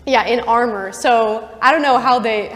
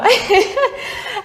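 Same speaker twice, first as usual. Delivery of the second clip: real burst of laughter; 12 semitones higher, 3 dB louder than usual. Real, not acted. Laugh at her own story.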